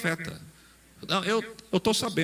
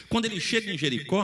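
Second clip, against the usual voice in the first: normal talking voice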